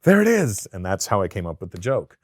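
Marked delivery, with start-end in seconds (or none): 0.0-0.7 gasp, then a loud, high exclamation; delighted discovery, pitched high and loud; retold sudden realization. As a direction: act out a eureka moment. Retold moment of finding the joke.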